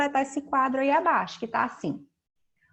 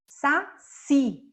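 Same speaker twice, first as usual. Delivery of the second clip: "saci" split into sa... CI, weight on the end; deliberate two-beat "saci", stressed on ci; syllable-by-syllable stress; 2 semitones higher, 4 dB louder than usual. Shown as a demonstration. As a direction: sound out "saci" slowly, punching the last syllable. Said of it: "É o si que é a sílaba tônica".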